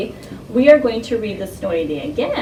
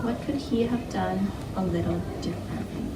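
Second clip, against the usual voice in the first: whispered instruction; 11 dB quieter than usual. Real, not acted. Teacher whispers instruction to class.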